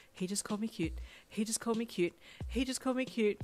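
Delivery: hushed start building into giddy disbelief; flustered delight. A narrator's reading. Shy girl replays the hero's compliment, flustered and giddy. Flustered thought repeated, escalating.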